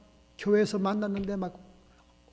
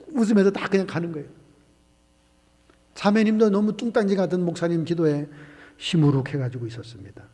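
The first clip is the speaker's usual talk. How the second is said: usual speaking voice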